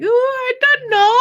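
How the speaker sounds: high, drawn-out feigned innocence; singsong "who knows?", loud and slow; coy cluelessness. Retold or imitated. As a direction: play innocent in a high, stretched voice. Acting clueless about the gift.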